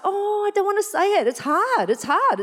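high, mock-whiny protest; reluctant whining. Acting out reluctance to obey God.

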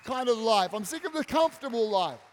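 high, loud, impassioned cry of total surrender; fervent surrender. Retold or imitated. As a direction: shout it out like someone finally giving everything to God. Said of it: "someone goes".

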